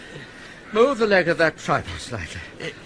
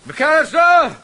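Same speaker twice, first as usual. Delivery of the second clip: loud call across the garden, higher; shouting a name; 8 semitones higher, 8 dB louder, slower than usual. Acted. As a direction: bellow for the gardener from afar.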